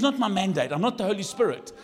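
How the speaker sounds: loud, high, building to a shout; full-force preaching. Real, not acted.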